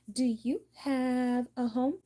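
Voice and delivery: high, slow, drawn-out question; mock go fish asker. Playing go fish, asking the other player for a card, high and drawn out.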